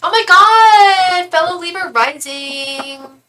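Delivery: high, loud, drawn-out delight; excited squeal. Real, not acted. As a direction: squeal it with joy. Excited reaction to shared sign.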